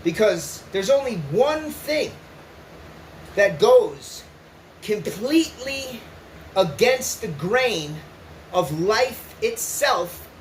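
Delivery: slowed, weighty delivery, each phrase spaced; slow, grave emphasis. Real, not acted. Telling listeners how suicide goes against life, slow and grave. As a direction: lay it down slowly like a hard truth. Solemn point about suicide.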